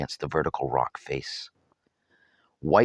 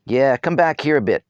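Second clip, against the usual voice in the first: calling him back; 8 semitones higher, 6 dB louder than usual. A narrator's reading. Girl calling friend back.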